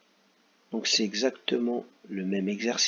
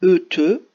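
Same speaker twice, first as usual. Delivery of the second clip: loud, higher questioning E te; trying out an option; 6 semitones higher, 10 dB louder than usual. Shown as a demonstration. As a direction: call out E te as a trial guess. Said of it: "est-ce qu'on va marquer avoir".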